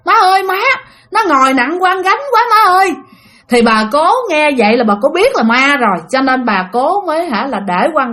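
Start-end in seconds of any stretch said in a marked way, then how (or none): 0.0-2.9 high, panicked retold cry for mom; girl calling her mother after seeing a ghost; frightened calling. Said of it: "mới chạy lên kêu".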